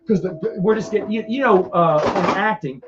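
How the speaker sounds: ordinary delivery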